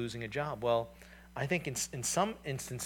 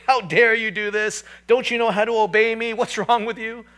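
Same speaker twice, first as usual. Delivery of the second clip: louder and higher, an imitated angry yell; mock scream at baby; 8 semitones higher, 11 dB louder than usual. Father imitating himself screaming at his baby at night, angry. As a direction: ham up a furious parent scolding. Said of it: "scream at the baby and say".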